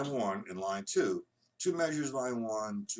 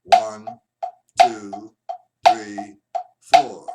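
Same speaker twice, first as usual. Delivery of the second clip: every count called out loud and hard; loud accented counting; shouted count; 2 semitones lower, 13 dB louder, much slower than usual. Shown as a demonstration. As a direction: hit each number like a drumstick. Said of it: "to hit the accent".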